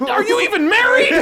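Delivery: very high, loud, squealing mock outrage; a shrill-pitched hammed-up prude cracking into laughs; prudish bully. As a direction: squeal it like a scandalized church lady.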